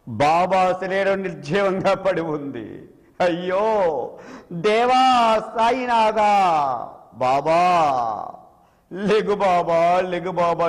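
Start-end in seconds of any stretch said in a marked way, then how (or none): 3.2-10.7 lightly voiced sobbing, pleading him to wake; a hint of tearful begging; sobbing plea. A narrator's reading. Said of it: "ఎక్కెక్కి ఏడుస్తున్నారు".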